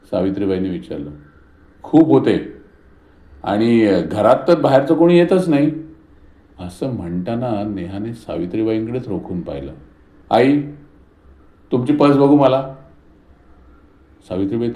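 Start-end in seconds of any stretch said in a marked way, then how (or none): 1.8-5.7 loud, high, pointed accusation; daughter-in-law accusing her mother-in-law, loud and angry. A narrator's reading. 10.3-12.7 loud, high demand; daughter-in-law demanding the purse, loud. A narrator's reading.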